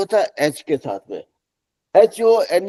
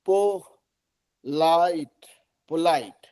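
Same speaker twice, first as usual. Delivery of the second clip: Polite stretched out slowly, each sound clear; teacher drawing out polite for the class to repeat; slow drill word; 4 dB quieter, much slower than usual. Shown as a demonstration. Teacher modelling polite slowly for students. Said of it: "इसको पांच बार सबको बोलेंगे".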